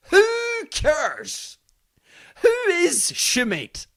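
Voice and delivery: high, scornful who cares; scornful dismissal. Real, not acted. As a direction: toss it off with contempt, slow on who is Schmidt. Dismissive rant about Schmidt.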